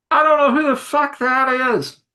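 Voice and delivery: high, loud yell from inside; annoyed yell. Acted. Anthony yells back to the doorman, annoyed high shout. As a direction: holler it back, pitched right up.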